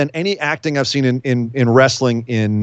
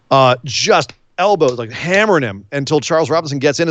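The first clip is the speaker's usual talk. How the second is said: ordinary delivery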